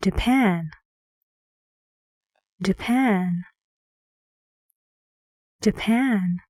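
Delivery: "Japan" with a short "Ja" and stressed "pan"; stressed second syllable. Shown as a demonstration. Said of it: "guess which syllable is stressed".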